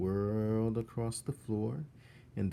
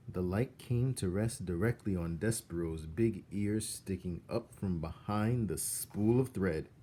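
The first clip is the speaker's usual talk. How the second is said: ordinary delivery, nothing marked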